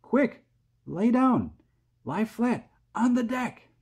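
high, urgent shouted orders; crying out. A narrator's reading. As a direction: shout short warnings to take cover. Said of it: "James cried".

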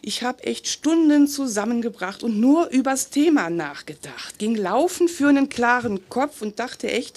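normal talking voice

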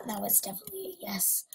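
quick joking whisper. Real, not acted. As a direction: whisper it to yourself. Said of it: "I think you said yes".

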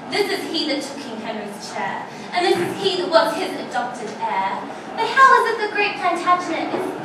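proud, scornful queen mocking a captured enemy; Queen Margaret taunting York. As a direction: declaim it like a vengeful queen gloating over her prisoner. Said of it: "I'm actually playing the role of Queen Margaret".

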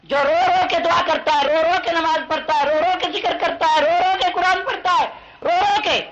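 high, rhythmic sermon chant; impassioned pulpit refrain; fervent preaching. Real, not acted. Preacher builds a rhythmic list.

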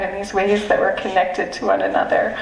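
normal talking voice

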